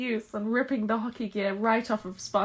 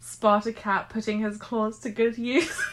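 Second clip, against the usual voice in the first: ordinary talk, nothing marked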